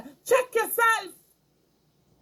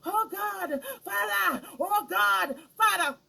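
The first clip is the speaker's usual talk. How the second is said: mock crying prayer; 3 semitones higher, 4 dB quieter, slower than usual. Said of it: "Then you're crying".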